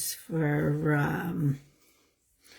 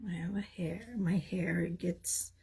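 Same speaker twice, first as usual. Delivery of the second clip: whispering; 10 dB quieter than usual. Real, not acted. Whispered aside about falling hair.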